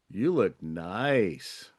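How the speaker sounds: "You look nice" dragged out very slow and a bit low; creepy. Shown as a demonstration. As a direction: linger on every word like a creep. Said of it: "but now it's kinda creepy".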